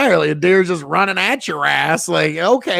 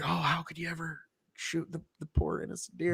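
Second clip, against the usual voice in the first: much softer, mock-tender pity; 16 dB quieter than usual. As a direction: sigh it out like a soft-hearted animal lover. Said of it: "I used to be like".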